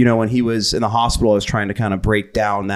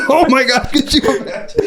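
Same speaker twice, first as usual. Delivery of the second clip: laughing, pitched way up. Real, not acted. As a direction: giggle through the words. Joking about lightning strikes.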